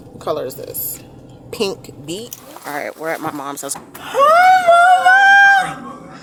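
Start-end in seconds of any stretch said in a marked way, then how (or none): 3.8-6.2 squealing high greeting. Real, not acted. Greeting her dog excitedly.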